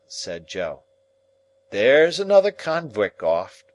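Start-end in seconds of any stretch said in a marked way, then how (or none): 1.7-3.6 Joe's reply. Acted. Joe answers.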